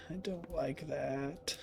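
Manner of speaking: near-silent, slow, frightened; scared whisper. Real, not acted. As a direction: barely breathe the words out. Repeated, even quieter.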